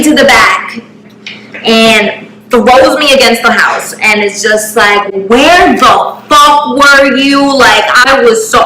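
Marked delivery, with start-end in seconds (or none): 5.1-7.4 slow, higher-pitched mock fury; acted angry demand, heavily stretched; imitating angry dad. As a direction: draw out each word like a furious father. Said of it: "I could tell he was terrified, disappointed, and angry".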